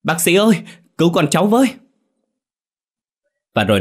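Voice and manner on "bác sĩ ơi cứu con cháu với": much higher, loud wail for help; full-throated cry for help; desperate scream. Acted. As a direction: scream for the doctor at the clinic door. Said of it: "gào lên".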